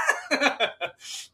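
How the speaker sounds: high burst of laughter. Real, not acted. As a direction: laugh it off loud. Laughing after the cheer.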